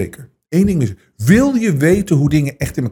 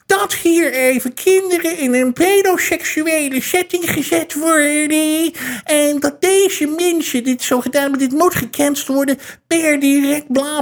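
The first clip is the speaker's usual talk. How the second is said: mocking outraged critics; 10 semitones higher than usual. Mocking the humourless group's outrage in a high whine. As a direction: parody the offended humourless crowd. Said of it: "Maar die mensen".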